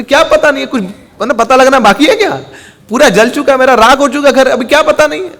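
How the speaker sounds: high, agitated retort; exasperated distress. Acted. Father with burnt house answers his son, exasperated. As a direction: throw it back at him, upset.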